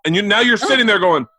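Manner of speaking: normal talking voice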